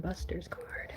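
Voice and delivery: whisper. Real, not acted. Finds card, whispers.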